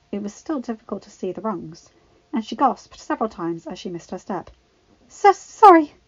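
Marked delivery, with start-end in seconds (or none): 5.1-5.9 high, loud, flustered apology; panting. A narrator's reading. Climber who just slipped, panting. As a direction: blurt it after a slip. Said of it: "she panted, breathing heavily".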